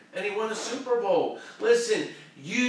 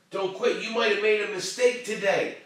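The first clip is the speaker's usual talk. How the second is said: ordinary delivery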